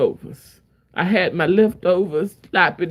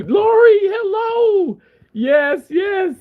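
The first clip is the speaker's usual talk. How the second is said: excited greeting; 13 semitones higher, slower than usual. Real, not acted.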